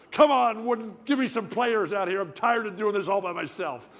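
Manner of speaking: high, loud complaint to the coach; yelling at the coach. Retold or imitated. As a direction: holler your grievance from the court. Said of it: "yelling at the refs".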